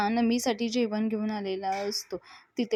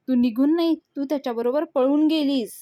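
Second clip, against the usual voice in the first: stern rebuke; 4 semitones higher, 7 dB louder than usual. Acted. Father scolding runaway daughter.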